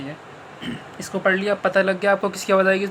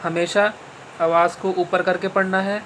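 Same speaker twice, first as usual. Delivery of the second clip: ordinary talk, nothing marked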